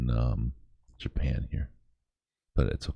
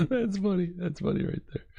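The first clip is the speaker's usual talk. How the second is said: laughing. Real, not acted. Still laughing after the joke.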